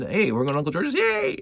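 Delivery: put-on bright, rising kid's cheer ending in a high yay; excited little kid. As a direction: play a six-year-old thrilled about the visit. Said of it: "you're a six-year-old or eight-year-old".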